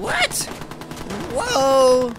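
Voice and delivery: high, loud startled yelp; startled shout. Real, not acted. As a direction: jump in shock and cry out. Suddenly attacked in game.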